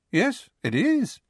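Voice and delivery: high, drawn-out mock surprise; put-on innocence, pitched high; feigned innocent surprise. A narrator's reading. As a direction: act unconvincingly innocent, slow and high. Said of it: "unconvincing look of innocent surprise".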